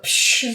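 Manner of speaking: quick "psh" whoosh of air; whooshing noise. Retold or imitated. Podcaster showing Max zooming ahead, imitated whoosh. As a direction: imitate a car shooting away.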